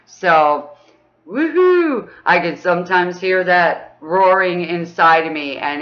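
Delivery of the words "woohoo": high cheering woohoo; a joyful cheer; excited woohoo. Real, not acted. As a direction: whoop with joy. Cheering in lesson.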